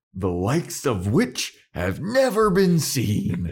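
slow, hammed-up grand proclamation; mock dramatic betrayal. Spike accusing Xander of betrayal, hammed-up and slow. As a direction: drag out the outrage like a stage villain. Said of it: "this is a betrayal".

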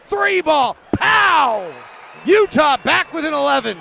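big, loud, high play-by-play call; excited shout. Real, not acted. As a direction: belt out the made three. Big three pointer call.

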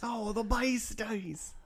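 slow, high Aussie drawl; laconic outback drawl. Acted. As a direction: draw it out, outback style. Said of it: "Yeah, Australian?".

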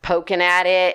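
ordinary talk

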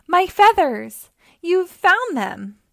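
high, loud, drawn-out delighted cry; excited calling out. A narrator's reading. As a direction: call down slowly, overjoyed at the find. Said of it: "Blue Jay called down".

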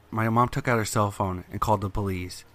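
ordinary delivery, nothing marked

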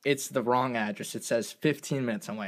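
ordinary talk, nothing marked

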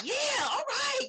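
high excited cheer. Retold or imitated. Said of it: "They were like".

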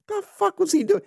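high-pitched exasperated outburst. Real, not acted. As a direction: let it leap up high in disbelief. Exasperated outburst at a player's mistake.

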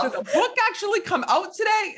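phone the publisher in disbelief; high incredulous query; high anxious question. Retold or imitated. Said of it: "be like".

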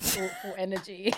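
ordinary delivery

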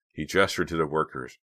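ordinary delivery

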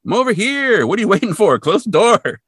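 cartoon Shaggy telling Scooby to shut the cage; imitating Shaggy urging. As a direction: hurry him from a distance. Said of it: "He's like".

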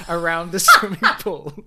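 ordinary talk, nothing marked

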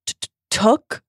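stammered, high tearful question; acted distraught disbelief; sobbing. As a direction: stutter it as if choking back sobs. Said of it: "sobbed Missus Cattermole".

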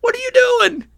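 indignant disbelief. Retold or imitated. John protesting that they hooked his fish, in mock outrage.

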